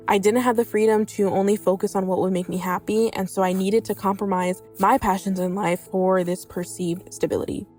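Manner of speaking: ordinary delivery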